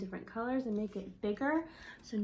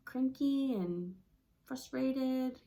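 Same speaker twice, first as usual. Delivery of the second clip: usual speaking voice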